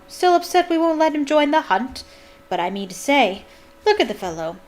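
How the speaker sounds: loud, hearty jeer; shouting. A narrator's reading. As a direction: call out mockingly to a crowd. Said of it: "shouted Sir Patrick's head".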